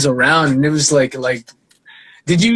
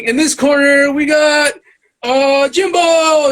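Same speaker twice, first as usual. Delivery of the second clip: high, slow, shouted ring-announcer intro; 9 semitones higher, slower than usual. Retold or imitated. Hosts introducing the rival beatboxer, hyped announcer shout. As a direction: boom it out like a battle MC. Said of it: "And then they're like".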